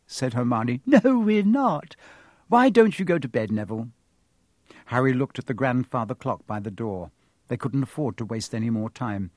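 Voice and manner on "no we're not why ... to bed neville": put-on flustered girl. Hermione brushes him off.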